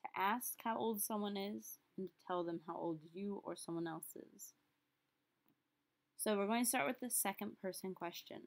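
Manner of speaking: normal talking voice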